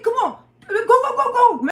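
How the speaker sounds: high, loud hurried go; urgent shouting. Retold or imitated. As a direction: rush her out, shouting. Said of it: "They said".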